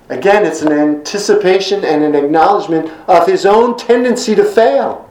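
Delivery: ordinary talk, nothing marked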